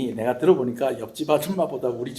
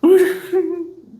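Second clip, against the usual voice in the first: mock crying wail; 7 dB louder than usual. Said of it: "주여!".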